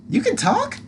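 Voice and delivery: high, startled question; surprised. Acted. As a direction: gasp in disbelief. Surprised at talking creature.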